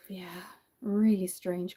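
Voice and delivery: sighed, breathy whispered aside; whispering in disbelief. Real, not acted. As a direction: drop to a hushed whisper, half to yourself. Sighing after weird date story.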